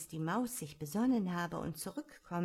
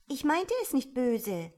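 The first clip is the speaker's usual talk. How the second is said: higher, louder protest of innocence; apologetic; 6 semitones higher, 6 dB louder than usual. A narrator's reading. Alice apologises to the departing mouse, apologetic. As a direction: plead that no harm was meant. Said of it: "entschuldigte sich die arme Alice".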